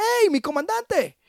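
cheery call. Retold or imitated. General cheerfully calls him.

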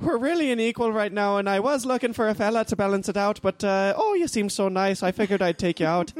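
put-on Irish accent. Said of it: "I'll play the role of the Irish guy".

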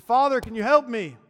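yelling up for help. Acted. Trapped man yells up to passing priest, loud desperate plea. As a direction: shout upward, pleading for rescue. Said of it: "he yelled up and he said".

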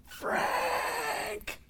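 a pained cry for a friend, held back; anguished scream. Retold or imitated. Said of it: "I screamed in my car at the top of my lungs".

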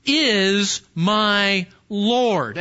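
drawn out very slowly, each word weighed; deliberate slow emphasis on is. Acted. Preacher stretching the key phrase.

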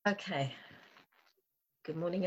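normal talking voice

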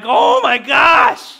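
slow, loud, high cry of distress; anguished shout. Retold or imitated. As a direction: re-enact a father's helpless outburst, drawn out. Father retells his distress at stitches.

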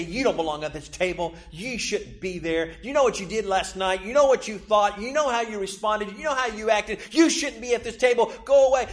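a hint of a taunting accuser who builds to go away; accusing enemy. The enemy telling the listener they don't belong, mounting accusation. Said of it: "what the enemy does is say".

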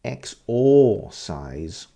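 exorcise drawn out slowly, syllable by syllable; teacher saying exorcise very slowly; slow careful exorcise. Shown as a demonstration. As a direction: spell out exorcise slowly for learners.

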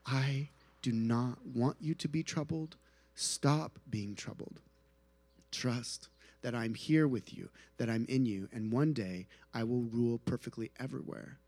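a hint of Jesus soothing a troubled friend; tender reassurance. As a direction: speak gently to someone in darkness. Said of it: "we can hear Jesus say".

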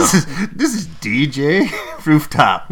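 slow DJ intro, breaking into a laugh; announce, laughing midway; radio DJ voice. Pretend.

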